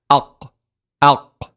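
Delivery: ALQ ending on an unbounced throat q; everyday Arabic qaf. Shown as a demonstration. Teacher modelling everyday Arabic for learners, throaty final qaf. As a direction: stop ALQ far back in the throat. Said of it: "how we pronounce this in everyday Arabic".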